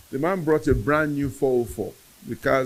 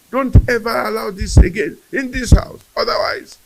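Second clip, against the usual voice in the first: high, loud retold rant; heated insults, each one hurled out high; screaming mother; 11 semitones higher, 8 dB louder than usual. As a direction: act out a mother's outraged scolding. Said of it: "She kept on screaming and screaming".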